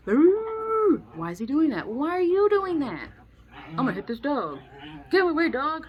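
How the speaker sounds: put-on high, loud whiny kid shouting; mock bratty kid yelling. A kid shouting at the family dog, high loud bratty yelling. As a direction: ham up a kid scaring off the dog.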